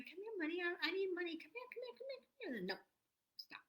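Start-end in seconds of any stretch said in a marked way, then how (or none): 0.0-2.6 higher and softer, needy begging; mock needy call, climbing high and soft; desperate pleading. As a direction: plead for the money to come, rising higher. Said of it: "it's like you're going".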